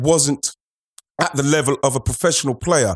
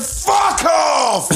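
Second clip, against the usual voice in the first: shouting; 14 semitones higher than usual. Retold or imitated. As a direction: yell it out in fury. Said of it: "he's literally jumped out and said".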